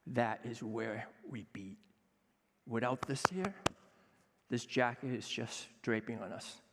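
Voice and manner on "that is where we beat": slow hushed whisper. Real, not acted. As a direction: breathe it, slowly, like a secret. Heart-felt line about chefs.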